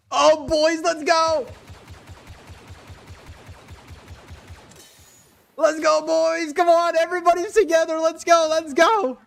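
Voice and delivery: high, loud hyped call to the squad; rallying shout. Real, not acted. Rallying teammates to escape.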